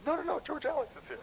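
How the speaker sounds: hammed-up alarm of a friend bolting for the car; hyper Steve. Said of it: "He can get pretty hyper".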